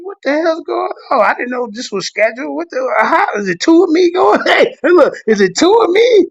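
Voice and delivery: high-pitched baffled squeal; mock bewilderment. Telling how he found himself unexpectedly billed, mock bewildered. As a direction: act out comically confused disbelief. Retelling his bewildered reaction to surprise listing.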